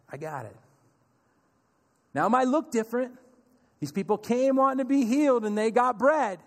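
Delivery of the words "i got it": very soft, low assurance; tender and steady; gentle Jesus. Retold or imitated. Jesus grieving with us, soft and tender.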